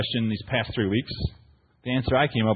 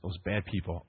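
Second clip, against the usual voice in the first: much softer, lower, conspiratorial imitation; hushed mock warning; 8 semitones lower, 10 dB quieter than usual. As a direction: lean in and warn them under your breath. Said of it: "He goes".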